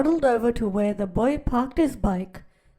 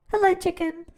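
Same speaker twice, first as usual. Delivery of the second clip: cheerful duck greeting; 7 semitones higher, 3 dB louder, faster than usual. Acted. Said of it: "said Duck".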